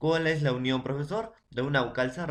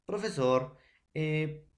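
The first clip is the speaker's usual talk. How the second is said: usual speaking voice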